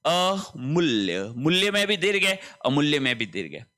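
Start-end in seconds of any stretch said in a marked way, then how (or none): 0.1-1.3 slow demo word. Shown as a demonstration. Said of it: "ध्यान रखें".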